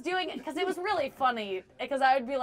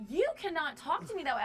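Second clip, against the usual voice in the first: higher, indignant retort, lightly acted; offended; 6 semitones higher than usual. Comic retelling how she told off a rude fan, mildly indignant. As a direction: put him in his place, mock offended. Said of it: "really offended".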